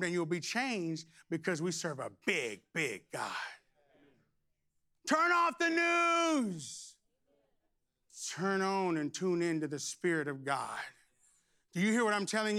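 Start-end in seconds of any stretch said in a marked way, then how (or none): 5.1-7.0 drawn-out, high, loud order; slow shouted command. Real, not acted. Preacher ordering the congregation, slow shout. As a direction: stretch every word out.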